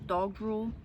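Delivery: ordinary delivery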